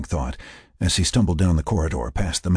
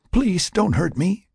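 frightened plea; 11 semitones higher than usual. Acted. Frank gives up, begging.

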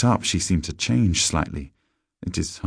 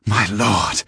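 glad cry; 4 semitones higher, 4 dB louder than usual. A narrator's reading. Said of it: "she cried".

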